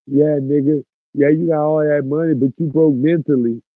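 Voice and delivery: high, loud sassy retort; mock comeback. Streamer voicing what critics should say, loud and sassy. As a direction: toss it off as a snappy comeback. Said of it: "You better, like".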